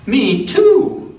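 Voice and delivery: eager me too; brave. Acted. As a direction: chime in bravely. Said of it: "felt brave and said".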